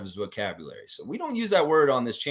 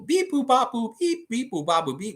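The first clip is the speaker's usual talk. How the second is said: high, mechanical beep boop; robot beeps; 11 semitones higher than usual. Pretend. Streamer answering bad audio with robot beeps. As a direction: chirp it like a toy robot. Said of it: "I sound like a robot".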